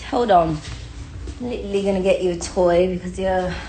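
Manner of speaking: ordinary talk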